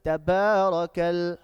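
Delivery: slow, loud recitation with stretched vowels; measured, sustained tajweed delivery; loud Quran recitation. Shown as a demonstration. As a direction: chant it out word by word for a class to repeat. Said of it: "Recite it loudly".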